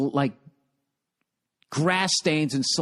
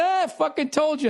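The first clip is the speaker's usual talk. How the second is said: tour guide quote; 11 semitones higher than usual. Retold or imitated. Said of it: "He goes".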